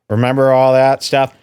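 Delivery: ordinary talk, nothing marked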